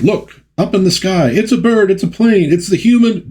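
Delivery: high-pitched mock crowd of gawkers; excited crowd onlookers. Crowd spotting a flying hero, excited high-pitched calls. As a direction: call it out high, like amazed onlookers. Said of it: "And from the crowd".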